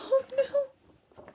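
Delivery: high gasping oh no; high alarmed oh no; shocked gasp. Acted. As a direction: react in alarm. Gasp at shark.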